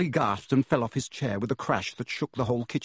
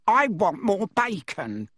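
put-on spoilt boy demanding; 8 semitones higher, 3 dB louder, slower than usual.

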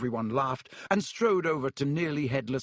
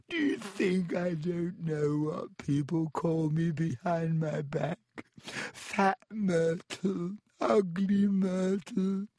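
wailing self-pity; 6 semitones higher, slower than usual. Acted. Ghost girl weeping self-pity.

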